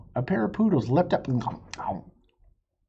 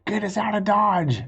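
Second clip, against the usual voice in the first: high urgent call to the driver; yelled; 6 semitones higher, 3 dB louder than usual. A narrator's reading. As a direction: order the driver to go. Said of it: "yelled Wild Bill".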